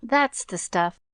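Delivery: plain reading voice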